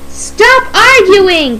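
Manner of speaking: high, drawn-out impatient cry. Acted. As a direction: cry out to break up a quarrel. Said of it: "cried the passengers".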